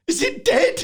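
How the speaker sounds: put-on very high, loud worried child; little kid voice. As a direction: squeak it like a scared small boy. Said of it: "My kid comes out and he's like".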